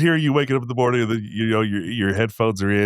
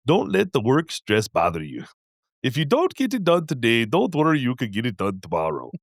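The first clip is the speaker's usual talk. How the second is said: hammed-up Indian accent, sing-song advice; 3 semitones higher than usual. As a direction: imitate an Indian motivational guy badly. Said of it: "he was Indian".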